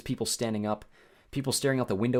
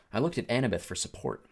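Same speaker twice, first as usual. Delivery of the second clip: usual speaking voice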